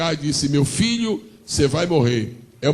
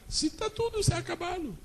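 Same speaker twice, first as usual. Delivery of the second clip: high, soft, broken woman's voice; mother in the desert giving up, high and hushed; hopeless Agar; 13 semitones higher, 10 dB quieter than usual. Acted. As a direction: sigh it out as if all is lost.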